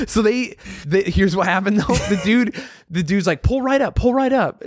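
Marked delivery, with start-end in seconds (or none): 3.4-4.6 high-pitched call to a customer; light, high imitation of a drive-thru guy; imitated drive-thru worker. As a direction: mimic a worker waving the car up.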